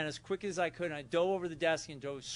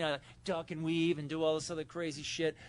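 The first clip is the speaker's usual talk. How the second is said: ordinary talk, nothing marked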